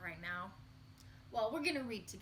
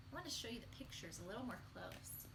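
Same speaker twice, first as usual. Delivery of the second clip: whisper; 8 dB quieter, faster than usual. Real, not acted. Teacher whispering to the children while showing the pictures. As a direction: drop to a whisper as you show the pictures.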